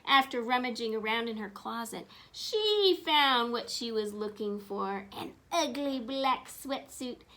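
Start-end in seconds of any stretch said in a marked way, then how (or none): none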